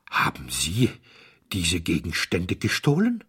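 a mildly shaken, stammering repeat of „haben Sie“; stammered repeat. Acted. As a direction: stumble back in on „haben Sie“ and push on. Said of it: "stotterte Mister Audley mit starrem Blick".

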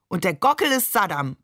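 usual speaking voice

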